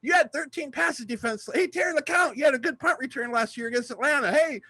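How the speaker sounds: put-on high, excited kid's yell of stats; yelling at players. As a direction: holler stats at the passing players. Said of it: "I'm yelling things out to the players".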